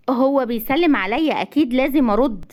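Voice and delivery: ordinary delivery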